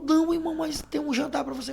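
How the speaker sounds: lightly imitated high-pitched protest; insistent church sister. Church sister repeating the dinner offer, insistent.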